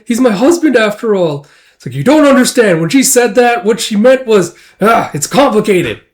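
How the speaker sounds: high, loud flustered protest. Acted. Wife defending her feelings for her husband, flustered. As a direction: blurt it out, acting all flustered. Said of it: "you're getting all flustered with".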